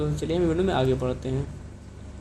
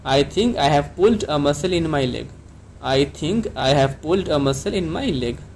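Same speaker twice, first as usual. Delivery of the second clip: slow, clear model sentence for learners; careful classroom dictation pace; slow teaching model; much slower than usual. Shown as a demonstration. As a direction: say it slowly so students can repeat. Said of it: "तो आप इसको कैसे कहेंगे?".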